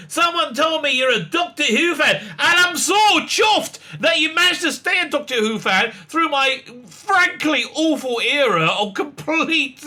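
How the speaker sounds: high, sugary mock Jodie voice; mock Jodie Whittaker. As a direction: gush down at a child. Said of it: "she didn't say that. I'm just making that bit up".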